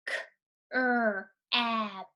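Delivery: "K, er, ab" split into separate sounds; sounding out crab. Shown as a demonstration. Teacher pointing at letters, sounding out slowly. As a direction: break "K, er, ab" into single sounds. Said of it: "Sound it out with me".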